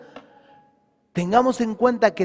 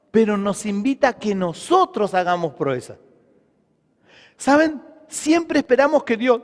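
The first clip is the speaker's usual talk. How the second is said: raised, emphatic sermon delivery; preaching; 5 semitones higher, 3 dB louder than usual. Real, not acted. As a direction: preach with force, leaning on key words. Resumes preaching after aside. (prédica enérgica y enfática)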